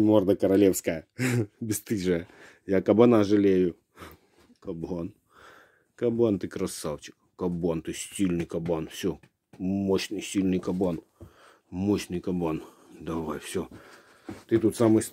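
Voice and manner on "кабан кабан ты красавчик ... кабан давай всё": softer, lower soothing praise; calm the dog down gently. Real, not acted.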